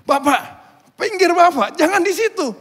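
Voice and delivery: high, loud call to move aside; shouted warning. Acted. Warriors to foreigner walking amid spears, shouted warning. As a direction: yell urgently across the battle lines. Warriors warn man between battle lines.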